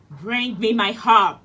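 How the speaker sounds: loud, commanding giant order; giant shouting. Acted. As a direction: bark the order at his wife. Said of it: "the giant shouted".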